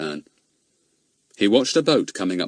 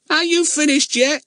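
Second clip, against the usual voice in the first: put-on high, harsh, grating old woman; 5 dB louder than usual. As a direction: snap it at him like a grumpy old crone. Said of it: "her harsh voice grating unpleasantly".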